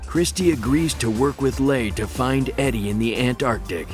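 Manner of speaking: ordinary talk, nothing marked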